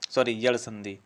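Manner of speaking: normal talking voice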